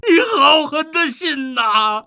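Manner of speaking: high, drawn-out tearful cry; wailing accusation. Acted. Bereaved father accusing the killer, wailing cry. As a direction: wail it slowly through tears. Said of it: "别瞎喊了".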